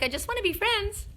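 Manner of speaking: high, sweet put-on pup voice; dog's inner voice. Owner voicing her dog who wants friendship, high sweet voice. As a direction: voice the dog, pleading to be pals. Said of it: "He's like".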